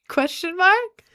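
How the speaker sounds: high, slow, laughing as she reads it out; laughing question. Real, not acted. Streamer laughing at a chat reply, giggling high.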